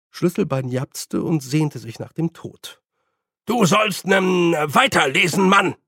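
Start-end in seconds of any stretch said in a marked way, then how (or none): 3.5-5.7 befehlend. Acted. Rektor befiehlt lauter.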